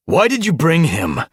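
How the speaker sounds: high, loud snarled demand; angry snarl. A narrator's reading. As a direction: snarl it at an underling. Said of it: "Splashtail snarled".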